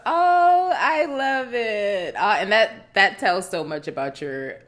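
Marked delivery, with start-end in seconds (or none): 0.0-2.1 loud, high opening, drawn out; gushing. Real, not acted. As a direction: melt with delight, stretching it slowly. Listener gushes over the proposal.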